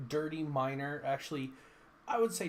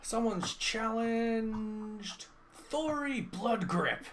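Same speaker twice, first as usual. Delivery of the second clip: higher, slow crowd call; announcer call; 9 semitones higher, slower than usual. Acted. Bartender announces the challenge to the tavern, high calling voice. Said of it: "bartender calls it out".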